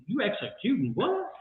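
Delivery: usual speaking voice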